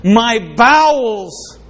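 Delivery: long, drawn-out high wail of anguish; anguished Jeremiah. Acted. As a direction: cry it out slowly as the tormented prophet, pitched high. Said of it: "what does Jeremiah feel about that".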